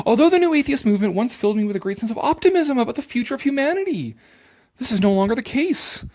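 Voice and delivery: high, loud mock-earnest reading voice. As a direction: read it like a smug preacher. Reading the essay's text mockingly.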